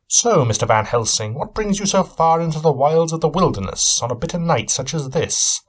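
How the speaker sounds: a hint of a keen, probing detective; Sherlock Holmes. Cabin owner sizing up his guest, lightly voiced shrewd questioning.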